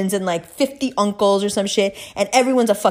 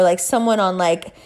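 ordinary talk, nothing marked

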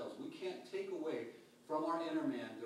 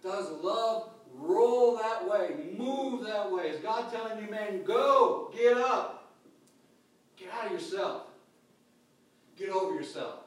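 loud, slow, emphatic exhortation; fiery preaching; 6 semitones higher, 11 dB louder than usual. Acted. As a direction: call them to get up and move.

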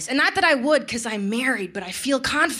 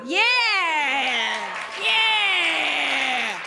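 soak up the cheer; hammed-up victory yeah; drawn-out yeahs; 3 semitones higher than usual. Real, not acted. Milking audience applause.

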